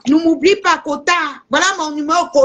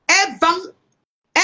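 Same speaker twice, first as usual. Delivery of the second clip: normal talking voice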